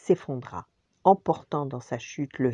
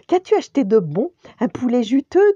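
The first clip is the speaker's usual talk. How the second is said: eager little sister; 8 semitones higher, 9 dB louder than usual. Acted. Hungry sister asks eagerly.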